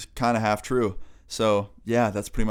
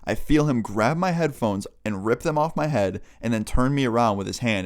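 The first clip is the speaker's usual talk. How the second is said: ordinary delivery, nothing marked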